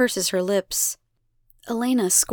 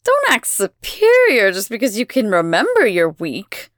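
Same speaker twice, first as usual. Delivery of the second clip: louder, dismissive scoff; 6 dB louder than usual. A narrator's reading. Elena dismisses Abigail's accusation, scoffing. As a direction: brush the child off with scorn. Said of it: "Elena scoffs".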